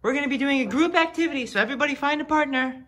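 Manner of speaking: high-pitched chirpy teacher voice; teacher addressing class. Acted. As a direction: call the class to order in a high voice.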